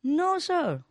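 high, loud squeaky no; a high loud mock woman's no; woman's reply. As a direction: pitch the no way up like the woman. Woman answers God.

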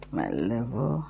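whispered note to herself; whispering. Real, not acted. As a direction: whisper while reading. Muttering while reading diary.

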